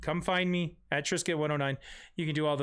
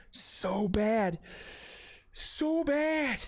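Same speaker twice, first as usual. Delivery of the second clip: mock-comic voice; 2 semitones higher, much slower than usual. Streamer mocking his own terrible impression, exaggerated comic voice.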